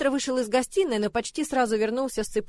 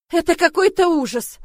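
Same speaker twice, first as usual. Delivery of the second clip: high, loud cry of dismay; horrified exclamation; 7 semitones higher, 8 dB louder than usual. A narrator's reading. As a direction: exclaim loudly, at wit's end. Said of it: "воскликнул Вильберт". (возглас ужаса, громко и высоко)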